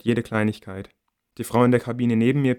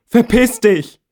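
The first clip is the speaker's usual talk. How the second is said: Verpiss dich screamed high and loud, falling; high, loud furious scream; 12 semitones higher, 11 dB louder, slower than usual. A narrator's reading. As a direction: scream Verpiss dich through tears at the door. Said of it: "schreie ich".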